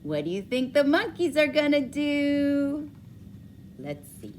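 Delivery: ordinary delivery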